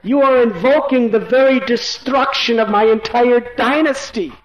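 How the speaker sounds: king's despairing plea, pitched high; anguished lament. A narrator's reading. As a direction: cry out that she will be his death. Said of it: "He spoke".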